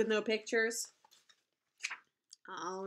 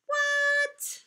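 high squeaky what; mock shock; 4 dB louder than usual. Teacher faking astonishment at the book, much higher. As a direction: gasp it out in pretend disbelief. Reader shocked by silly line.

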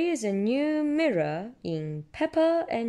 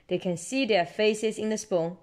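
ordinary delivery

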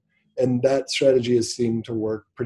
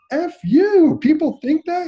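very high, loud disbelief; incredulous outburst; 7 dB louder than usual. Retold or imitated. Husband astonished by his wife's positive self-talk, high loud incredulity. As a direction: re-enact stunned disbelief, voice shooting up.

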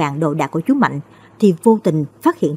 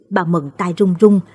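ordinary delivery

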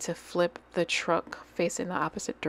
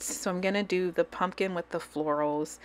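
usual speaking voice

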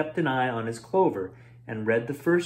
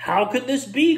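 higher, louder cry of dismay; 9 semitones higher, 4 dB louder than usual. Acted. Roy crying out at finding his clover eaten. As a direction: cry out in disbelief. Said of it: "cried Roy".

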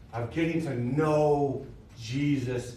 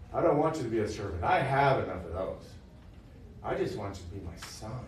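a hint of a gentle father, softer; tender father; 6 semitones lower, 6 dB quieter, faster than usual. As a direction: speak as a loving father reassuring his son. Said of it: "And he says".

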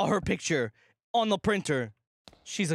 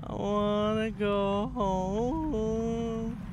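slow, wailing scared moan; long, miserable frightened wail; drawn-out wail; much slower than usual. Real, not acted. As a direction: stretch the vowels into a wobbling whine. Scared player moaning to leave.

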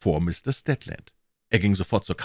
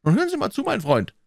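high-pitched, insistent address; firm call for attention, dropping on the last words; commanding; 3 dB louder, faster than usual. Acted. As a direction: take charge and make him listen. Banker takes command.

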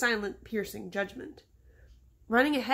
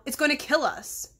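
raised, higher panicky cry; yelled; 5 semitones higher, 4 dB louder than usual. A narrator's reading. Scared boy warns his friend about the snake, yelling. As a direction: yell it in alarm, stopping short of a scream. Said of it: "he yelled".

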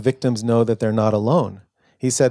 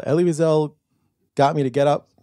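a light Southern drawl, pitched up, lightly imitating President Clinton; Clinton's drawl; 5 semitones higher than usual. Mimicking how President Clinton later praised Elie Wiesel, in a light Southern drawl. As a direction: ease into a mild Arkansas drawl, quoting Clinton. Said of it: "in his drawl".

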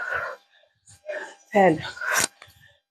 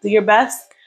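ordinary talk

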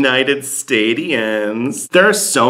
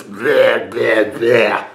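mock sloppy kissing noise; much slower than usual. Imitating bad kisses.

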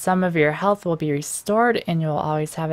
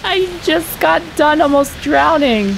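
panicky; 8 semitones higher, 5 dB louder than usual. Real, not acted. Panicking in flooding room.

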